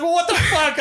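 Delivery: hammed-up angry squeal; mock pissed-off. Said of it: "he got so pissed".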